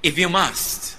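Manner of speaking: ordinary talk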